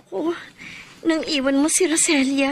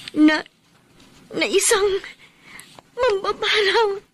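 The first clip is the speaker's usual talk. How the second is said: rising, tearful catch; voice breaking; 6 semitones higher than usual. Acted. Woman warning her ex about the sorceress, tearful. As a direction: fight back tears as you say it.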